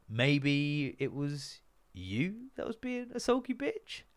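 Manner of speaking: slow mocking schoolyard taunt; mock sulky jibe. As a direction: drawl the insult slowly to wind them up. Mocking exchange quoted between them.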